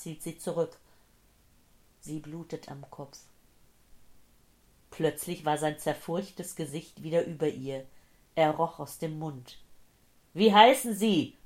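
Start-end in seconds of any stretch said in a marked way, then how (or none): 2.0-3.2 soft, detached repetition; muted echo. Acted. Dazed woman hearing the old man's words, soft and muted. 10.3-11.4 loud, high urgent shout; bellowed question. A narrator's reading. Old man to semiconscious woman, bellowing. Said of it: "brüllte er".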